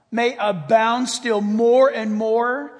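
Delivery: slow, weighty scripture reading; deliberate preaching cadence; slow emphatic reading. Acted. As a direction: lean on each phrase, slowly.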